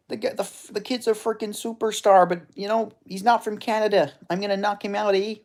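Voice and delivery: high, hammed-up Canadian fighter; Canadian accent. Canadian fighter sizes up the superstar kid, mock Canadian accent. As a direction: do a high-pitched Canadian tough guy. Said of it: "my Canadian accent is good".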